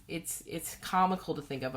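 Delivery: ordinary talk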